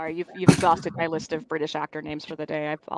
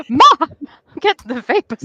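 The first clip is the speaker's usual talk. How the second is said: mock shocked swoon; 8 semitones higher, 7 dB louder than usual. Mock swooning at the swear phrase.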